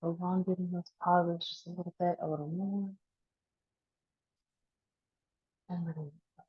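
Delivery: spare, drawn-out cues with long silences between; guided breathing meditation. Acted. Teacher counting down the last breaths, guided meditation.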